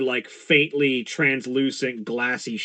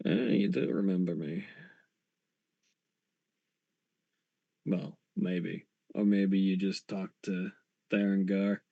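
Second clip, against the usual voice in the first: low, hushed voice of a shady ghost uncle; Hildat; 5 semitones lower, 9 dB quieter than usual. Acted. Ghost uncle answers his returning relative, low and hushed. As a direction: speak low and soft, as the rogue spirit.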